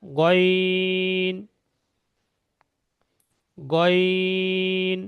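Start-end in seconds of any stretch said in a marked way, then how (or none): 3.6-5.0 long "গইন" with rounded lips, from the throat; rounded mouth. Shown as a demonstration. Teacher showing starred letter, lips rounded. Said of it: "মুখটা গোল করে বলতে হবে".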